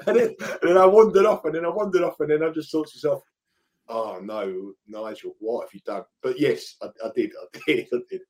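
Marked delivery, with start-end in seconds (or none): 3.9-6.0 low, soft muttering to yourself; dismayed self-reproach. Retold or imitated. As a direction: scold yourself under your breath, pitched down. Retelling dismayed talk to himself.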